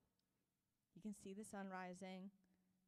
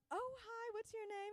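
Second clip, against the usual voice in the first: much higher, louder put-on hello; high-pitched greeting; 13 semitones higher, 4 dB louder than usual. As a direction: act out an over-polite first hello, pitched way up. Acting out awkward first meeting.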